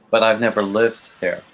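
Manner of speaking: ordinary talk, nothing marked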